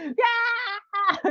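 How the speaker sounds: high sharp mock yell; angry child yell. Boy yelling at the counselor in anger, mock yell. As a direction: yell it like a furious kid. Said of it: "이렇게 욕이 나오는 거예요".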